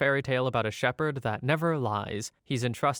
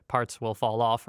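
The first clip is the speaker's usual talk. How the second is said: ordinary delivery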